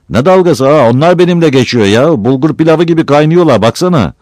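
high, loud indignant comeback; loud protest. Acted. Teased man answering his friend about the women, loudly protesting.

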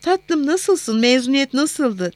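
an excited mother's lilting question; enthusiastic mother. A narrator's reading. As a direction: greet a loved one with lifted enthusiasm. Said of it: "Hattın diğer ucundan coşkuyla".

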